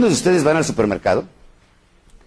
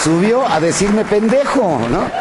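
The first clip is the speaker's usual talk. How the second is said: en riant; 7 semitones higher, slower than usual. Real, not acted.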